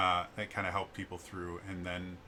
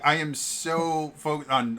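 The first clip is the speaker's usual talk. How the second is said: ordinary delivery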